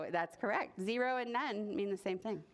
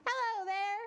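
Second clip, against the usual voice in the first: high, friendly hello; lightly voiced friendly tree; tree's greeting; 10 semitones higher than usual. Tree surprising the boy, high friendly greeting.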